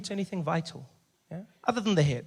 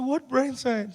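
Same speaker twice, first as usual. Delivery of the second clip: incredulous; 9 semitones higher, slower than usual. Retold or imitated. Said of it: "I said".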